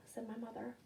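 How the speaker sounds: plain reading voice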